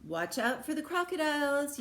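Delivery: high, loud teasing call; teacher yelling a teasing warning; playful yell. Acted. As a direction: yell it back as a joke warning. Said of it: "you yelled back".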